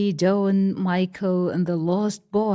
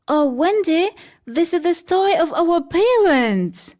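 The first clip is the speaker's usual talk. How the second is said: put-on higher child's voice, wistful; little girl Dawn; 9 semitones higher, 5 dB louder than usual. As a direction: play the young girl realising something.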